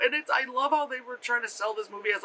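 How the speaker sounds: usual speaking voice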